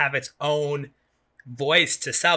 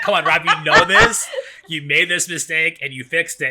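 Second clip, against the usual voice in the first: louder and higher, laughing through mock self-scolding; laughing at her own mistake, louder; 3 semitones higher, 6 dB louder than usual. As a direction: scold yourself while laughing at it.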